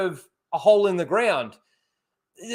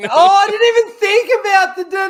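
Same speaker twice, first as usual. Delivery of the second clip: excited realization; 13 semitones higher, 9 dB louder than usual. Real, not acted. Sudden Wizard of Oz realization.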